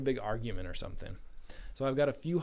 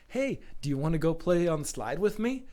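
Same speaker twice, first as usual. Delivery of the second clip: cheerful invite; 5 semitones higher, 7 dB louder than usual. Acted. Kid inviting a bullied friend.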